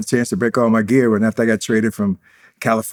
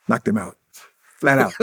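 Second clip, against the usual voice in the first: ordinary talk, nothing marked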